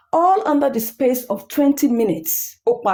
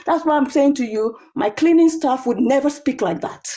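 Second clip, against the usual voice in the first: ordinary delivery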